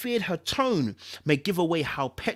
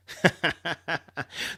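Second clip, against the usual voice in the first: a hint of a sinister caller laugh; villain laugh; 3 dB quieter than usual. Caller mocking the frightened Sky, mild villain laugh. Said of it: "The mysterious caller laughed".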